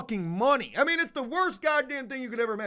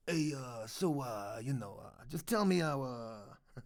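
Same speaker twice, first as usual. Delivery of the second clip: mock mafia tough guy; 9 semitones lower, 9 dB quieter than usual. Bluffing tax people in a mafia voice, lower. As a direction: lower and softer, a hint of a mob boss. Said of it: "I can't even talk like that".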